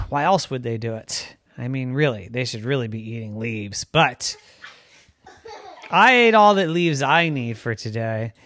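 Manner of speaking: usual speaking voice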